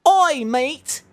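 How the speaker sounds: high-pitched mock Londoner; bad Londoner accent. Greeting a mate in a terrible, high Londoner accent. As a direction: call out in a hammed-up London accent. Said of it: "Londoner accent".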